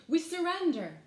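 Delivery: loud, high cry of surrender. A narrator's reading. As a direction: call out in theatrical defeat. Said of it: "Then all the hoods cried".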